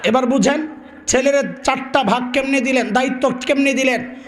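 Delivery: normal talking voice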